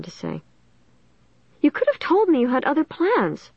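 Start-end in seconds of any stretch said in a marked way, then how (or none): 1.6-3.5 high, shrill accusation. A narrator's reading. Margaret interrupts her friend's apology, shrill. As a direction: cut in shrilly, wounded and reproachful. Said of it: "Margaret interrupted shrilly".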